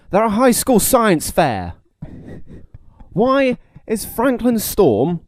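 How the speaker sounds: loud, high, drawn-out disbelief; incredulous. Real, not acted. Airing an issue with a film's plot, incredulous. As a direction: stretch out each word in exasperated disbelief.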